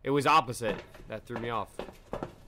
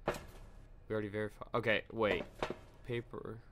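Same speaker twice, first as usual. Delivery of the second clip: hushed; 4 semitones lower, 8 dB quieter than usual. Real, not acted. Tense quiet checking.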